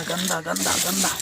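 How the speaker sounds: usual speaking voice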